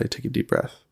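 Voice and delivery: softly retold soothing words to a child; relaxed, calming. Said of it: "relax and put my hand on her chest and say".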